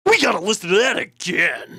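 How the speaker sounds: big booming arcade announcer shout; booming fighting game announcer. Pretend. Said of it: "a Street Fighter font and vocal sound saying".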